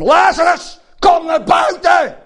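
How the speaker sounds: a high, loud, ringing summons; slow, loud command; commanding shout. Acted. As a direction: call the dead man out of the grave. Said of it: "riep hij met krachtige stem".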